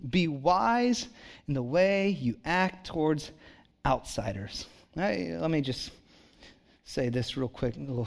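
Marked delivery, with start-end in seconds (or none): 0.1-4.7 slow, weighty reading of the verse; slow scripture reading. Shown as a demonstration. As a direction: lean into every word, slowly. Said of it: "Now listen to this".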